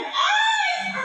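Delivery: very high startled shriek with a laugh; scared shriek. Retold or imitated. The family screaming as the car slides down, a startled shriek. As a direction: shriek as the car rolls back. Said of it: "Parang kami sigaw".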